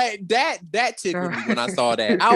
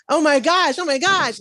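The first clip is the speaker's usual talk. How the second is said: mock high, louder squeal of alarm; pitched-up voice; 10 semitones higher, 4 dB louder than usual. Host mocking men who pitch their voices up, high exaggerated voice. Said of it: "He's not pitching his voice like".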